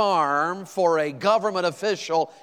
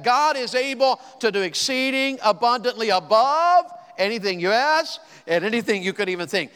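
preaching at full force; 6 semitones higher, 4 dB louder than usual. Acted. Preacher declaiming verse twenty to the congregation, full force. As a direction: lift the verse up like a shout of praise.

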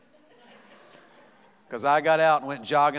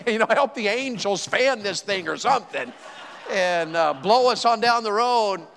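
retold desperate prayer; 5 semitones higher than usual. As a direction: beg God to get the truck over the bridge. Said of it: "And I was praying".

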